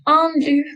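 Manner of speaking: "loup" said as "lou", no final consonant; French teacher modelling "Un loup" with silent ending. Shown as a demonstration. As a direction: drop the final letter of "loup". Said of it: "so it's silent".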